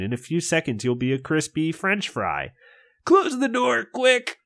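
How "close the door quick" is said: much higher, urgent shout. Acted. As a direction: yell it at your friend in pain. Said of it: "you shout at Gabe".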